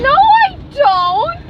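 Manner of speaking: loud, high, stretched-out protest; indignant denial. Real, not acted. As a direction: cut off the teasing with a wailing objection. Denies teasing about crying.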